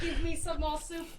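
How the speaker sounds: Cockney Oliver Twist. Acted. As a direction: beg in a mock Cockney accent. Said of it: "Oliver, Oliver Twist, like, Cockney".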